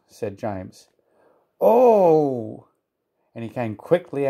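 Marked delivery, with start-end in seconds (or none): 1.6-2.7 louder, higher, drawn-out oh; storyteller's dismayed exclamation; dawning dismay. A narrator's reading. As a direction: let a big oh swell and fall. James realises he was tricked.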